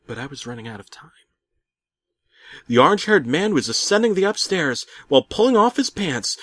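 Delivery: ordinary delivery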